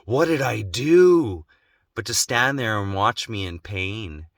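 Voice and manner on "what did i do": slow caring voice, a hint of a worried mother; tender mother concern. Mother he wished had come to comfort him, slow caring. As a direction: ask gently what's wrong. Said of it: "not to come to her son, say".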